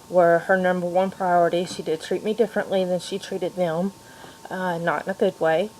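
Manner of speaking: ordinary delivery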